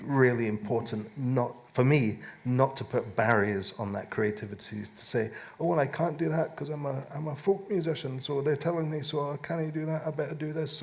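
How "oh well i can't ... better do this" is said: higher mock-meek voice; imitated excuse-making. Musician mimics limiting himself to folk, mock meek. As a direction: run through feeble excuses in a higher voice.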